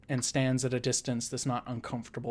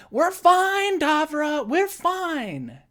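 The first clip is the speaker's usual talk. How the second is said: very high and loud call back; 10 dB louder, much slower than usual. Acted. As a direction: shout reassurance across the street. Calling back out the door.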